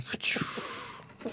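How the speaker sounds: whispered fake sneeze. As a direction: mime a sneeze. Imitated sneeze.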